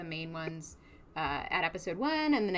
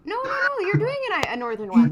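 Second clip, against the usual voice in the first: excited correction; 14 semitones higher, 10 dB louder than usual. Real, not acted. Excitedly correcting accent guess.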